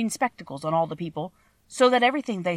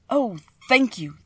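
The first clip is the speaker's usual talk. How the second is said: slow thanks rising higher the second time; grateful cry; 5 semitones higher, 4 dB louder, slower than usual. Acted. Scarecrow thanks the wizard for brains, overjoyed. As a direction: gush with relief and thanks. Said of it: "cried the Scarecrow".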